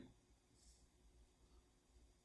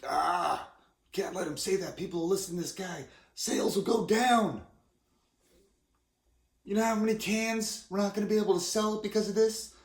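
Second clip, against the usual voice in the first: panicked alcohol lobbyist; 7 semitones higher, 6 dB louder than usual. Retold or imitated. Alcohol lobbyists fretting over falling sales, hammed-up louder outcry. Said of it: "alcohol lobbyists that are".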